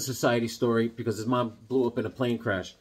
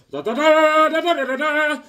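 high, loud sung da da da; mock action soundtrack; 8 dB louder than usual. Atom Smasher jumping off the mountain with Bigfoot, a high sung da da da. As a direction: chant the leap like a cartoon score.

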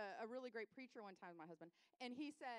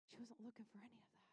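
ordinary delivery

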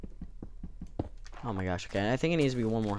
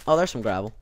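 normal talking voice

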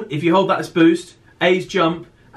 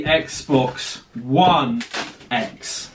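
dramatic reveal; 8 semitones lower, much slower than usual. Acted. Dramatic unboxing reveal of console.

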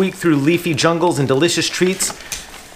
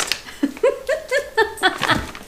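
a high burst of laughter; 4 dB quieter than usual. Real, not acted. Amused by the banner in the box, laughing high.

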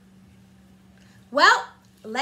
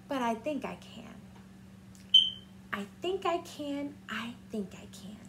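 fading from soft to whisper; hopeful whisper build-down; 5 semitones lower, 12 dB quieter than usual. Acted. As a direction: let each I think I can grow quieter until whispered. Little engine hopes.

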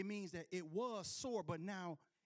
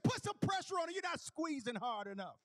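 mock bossy command; 8 semitones higher, 5 dB louder than usual. Retold wife repeating order.

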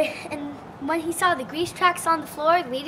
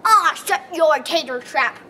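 roared; 8 dB louder than usual. A narrator's reading. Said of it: "roared the giant".

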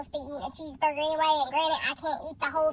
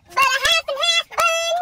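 indignant rant; 7 semitones higher, 4 dB louder than usual. Real, not acted. Ranting about the half-and-half bun.

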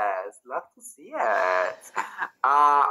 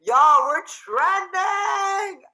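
very high, drawn-out, slow joyful shout; excited shout; 6 dB louder, much slower than usual. Real, not acted. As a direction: yell the news out in delight. Announcing the stream is trending.

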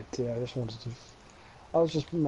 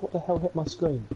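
ordinary talk

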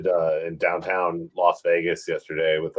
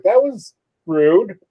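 high, louder, slow drawn-out huff; offended stranger; 10 semitones higher, 7 dB louder, much slower than usual. Retold or imitated. Rebuffed stranger in Las Vegas, slow high offended drawl. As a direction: stretch it out, wounded. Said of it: "He's like".

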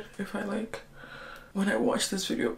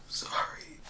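slow, hushed whisper between sobs; real teary whisper; 6 dB quieter than usual. Real, not acted. Filming herself crying before dawn, teary whisper. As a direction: keep it a secret whisper, barely voiced. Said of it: "I don't know why I'm whispering".

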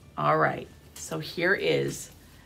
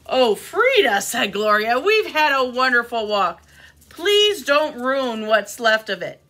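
loud, animated storybook dialogue; expressive storytime delivery; reading to children; 5 semitones higher, 6 dB louder, slower than usual. A narrator's reading. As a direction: read the friends' squabble out emphatically. Reads picture book to children.

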